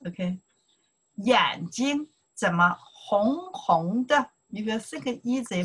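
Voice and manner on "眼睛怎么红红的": slow model line. Shown as a demonstration. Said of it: "Follow me to pronounce here".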